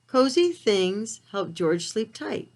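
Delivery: plain reading voice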